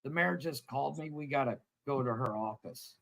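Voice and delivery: put-on dad telling the kids they're busted; grim low warning. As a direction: drop the voice, you're in trouble now. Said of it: "He's like".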